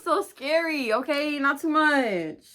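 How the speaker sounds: dramatic. Real, not acted. Teen admitting her crush was scary, hammed-up. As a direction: stretch it out, half-joking. Exaggerated reaction.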